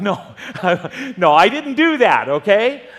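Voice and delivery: loud, high, laughing take-back; a pastor laughing off his own gun joke; laughing denial. Real, not acted.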